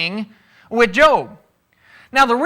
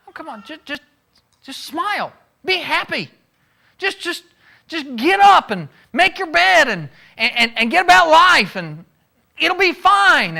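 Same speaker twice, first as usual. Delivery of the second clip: mock cheery dismissal; 7 semitones higher, 3 dB louder than usual. Mocking dismissive advice voiced.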